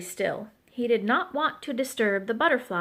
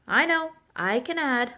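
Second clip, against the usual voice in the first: usual speaking voice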